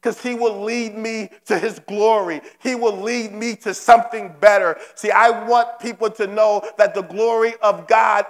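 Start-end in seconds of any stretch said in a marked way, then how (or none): none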